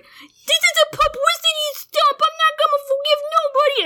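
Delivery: high, fast, whiny mock outburst; bratty teen yell. Friend voicing a sulking teen's outburst, high and whiny. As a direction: whine it out like a sulking kid. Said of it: "he's like".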